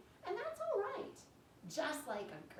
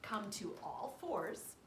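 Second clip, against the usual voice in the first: normal talking voice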